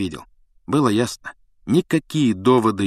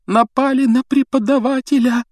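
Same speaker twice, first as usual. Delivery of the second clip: higher and louder horrified cry; the horror sinks in fully; horror rising; 5 dB louder than usual. A narrator's reading. Hermione repeats it over Snape, horror rising. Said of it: "В ужасе прошептала Гермиона".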